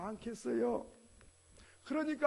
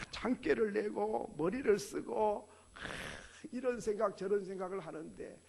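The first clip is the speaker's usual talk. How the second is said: ordinary talk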